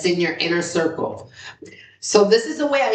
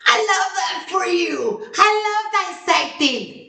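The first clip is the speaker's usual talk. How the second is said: hyped cheer; 12 semitones higher than usual. Retold or imitated. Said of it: "And I'm like".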